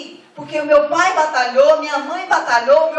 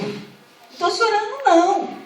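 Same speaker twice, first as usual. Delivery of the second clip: higher, whimpering mock protest; whiny denial; 6 semitones higher than usual. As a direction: deny crying in a tearful whine. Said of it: "Para de chorar agora". (negação chorosa e manhosa, mais aguda)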